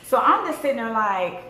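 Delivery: ordinary delivery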